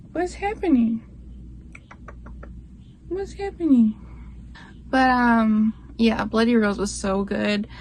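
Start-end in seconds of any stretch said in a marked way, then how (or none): 0.1-3.9 pet talk. Real, not acted. Talking to her pet.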